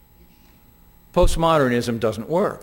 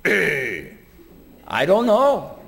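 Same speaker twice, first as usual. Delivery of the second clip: lightly voiced Fonzie shrug; a hint of the cool Fonz, unbothered; offhand mock Fonzie reply; slower than usual. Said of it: "Remember the Fonzie?".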